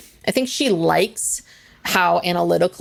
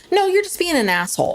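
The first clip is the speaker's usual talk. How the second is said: high, blunt comeback; blunt retort; 9 semitones higher than usual. Retold or imitated. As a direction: snap back with disbelief. Retorting to rude woman.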